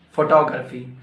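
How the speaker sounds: "Photography" as fo-TOG-ra-fee; corrected PHOTOGRAPHY. Shown as a demonstration. Teacher correcting learners, "Photography" said as fo-TOG-ra-fee. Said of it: "No, it's incorrect".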